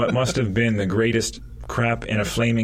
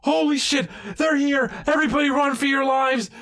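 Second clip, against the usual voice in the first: high panicked yelling; yelling while running away. Pretend. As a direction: scream and flee. Fleeing tribesmen panic.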